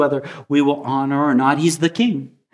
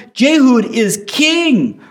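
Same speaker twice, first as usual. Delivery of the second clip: slow, ringing proclamation; higher and louder royal proclamation; proclaiming; 6 semitones higher, 4 dB louder, much slower than usual. Retold or imitated. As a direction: announce it slowly like a herald. Crowd proclaims a king.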